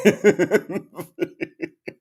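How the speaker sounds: high, wheezy giggle; high helpless laugh; real laughter. Real, not acted. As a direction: break up laughing. Laughing at a chat comment.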